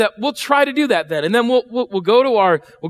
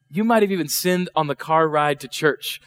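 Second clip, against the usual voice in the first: ordinary delivery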